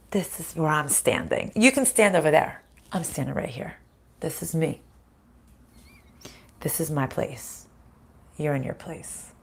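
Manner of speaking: low, soft and steady; quiet and firm. Acted. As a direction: calmly hold your ground. Calm firm boundary speech.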